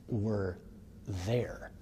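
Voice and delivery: ordinary talk, nothing marked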